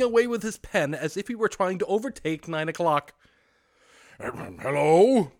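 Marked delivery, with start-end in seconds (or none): 4.2-5.3 loud feigned growl; mock growl. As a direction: fake the old gruff voice. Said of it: "growled Scrooge".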